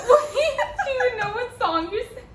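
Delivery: high, laughing tease. Real, not acted. Host teasing the singer, laughing out the question. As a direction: ask it through real laughter.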